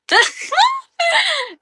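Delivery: high, squealing burst of laughter; shrieking laugh. Real, not acted. Streamer laughing at viewer's teasing, squealing laugh. Teased by Kuya Sean.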